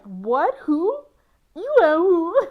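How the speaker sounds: high, loud shocked squeal; loud baffled cry of who; incredulous shriek. Retold or imitated. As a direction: squeal it in disbelief. Shocked reply to "months" claim.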